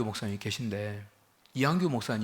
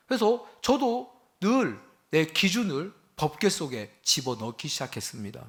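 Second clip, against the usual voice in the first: ordinary delivery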